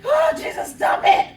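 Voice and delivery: high, loud desperate cry for help; panicked shout. Retold or imitated. Storyteller pinned under rolling van begs Jesus, panicked shout. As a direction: shout a frantic plea to heaven.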